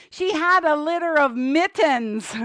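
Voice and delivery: lightly voiced puppet comic; puppet punchline. Penny gives the host the punchline, lightly voiced puppet. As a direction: land the joke as a puppet.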